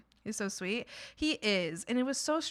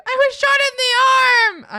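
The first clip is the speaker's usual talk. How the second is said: much higher and louder, slow and stretched; sarcastic scream at a doctor, high and loud; mock outraged yell; 14 semitones higher, 16 dB louder, slower than usual. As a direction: belt it like a wounded patient. Mocking doctor's period question.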